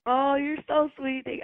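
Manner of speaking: ordinary talk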